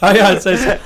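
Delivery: bursting with laughter as she jumps in; laughing eagerly to take the floor. Real, not acted. Excited to tell the story.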